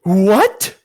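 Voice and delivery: high, loud furious roar; a queen roaring in disbelief, high and loud. Acted. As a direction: blast the word out. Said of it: "roared the witch".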